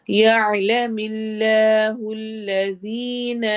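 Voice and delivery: slow melodic tajweed chant; measured Quranic recitation. Shown as a demonstration. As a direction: recite slowly, stretching the long vowels. Recites verse phrase.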